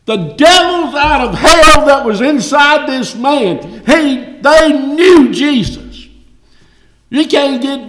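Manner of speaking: high, loud and slowing on knew; preaching at full force. Acted. Preacher declaring to the church that demons knew Jesus, loud. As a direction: hammer it home from the pulpit.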